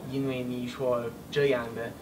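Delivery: ordinary delivery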